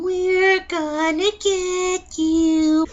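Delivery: high, very slow, eerie "We're gonna get you"; drawn-out horror-movie child taunt; Evil Dead baby. Retold or imitated. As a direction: stretch it out like a demon child. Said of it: "call that baby the Evil Dead baby".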